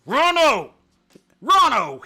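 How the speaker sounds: loud high shout of the name; calling out. A narrator's reading. Bambi calling after Rano chasing Feline, loud. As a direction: yell across the meadow. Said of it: "called Bambi".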